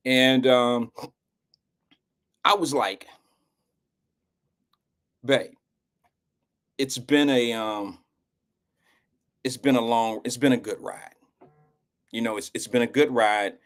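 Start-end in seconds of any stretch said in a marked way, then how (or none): 5.2-13.6 low, halting, understated emotion; retold emotional farewell. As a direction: pause often, as if searching for words. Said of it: "I had an emotional moment".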